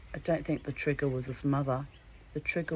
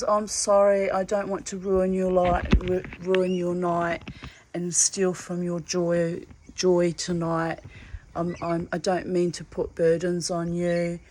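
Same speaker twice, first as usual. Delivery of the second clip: louder, drawn-out mock plea of apology; retold tearful apology; 3 semitones higher, 6 dB louder than usual. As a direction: imitate an earnest, upset apology, slowly. Retelling his tearful apology.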